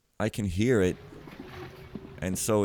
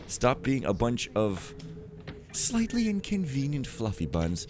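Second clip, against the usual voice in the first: restrained exasperation; 3 semitones higher than usual. Real, not acted. Player toning down an insult to game characters, restrained. Softened redo of his own outburst.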